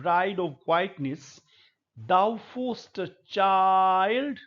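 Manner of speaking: very slow, drawn-out verse reading; poem recitation. Acted. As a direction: savour each word of the ode. Recites Keats poem slowly. (कविता का बहुत धीमा, खींचा हुआ पाठ)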